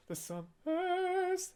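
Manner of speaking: the summers sung high and soft in falsetto. Shown as a demonstration. Reaction host demos falsetto for viewers, high and soft. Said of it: "I'll just do falsetto for this".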